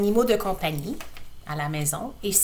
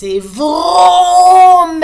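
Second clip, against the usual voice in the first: high, loud vroom yell; shouted vroom; 9 semitones higher, 12 dB louder than usual. Acted. Laurie speeding down the road, shouted vroom. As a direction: shout the engine roar. Chair at ninety-second speed.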